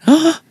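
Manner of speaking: a very high gasp of joy; a high, joyful shriek on seeing the gift; delighted squeal. Retold or imitated. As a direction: squeal with surprise. Wife sees the picture.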